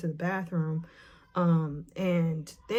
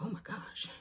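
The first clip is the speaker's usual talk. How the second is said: breathless whispered gasp of shock; 4 semitones higher, 12 dB quieter than usual. Retold or imitated. Hearing her friend fainted, she whispers in shock. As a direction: barely voice it, stunned.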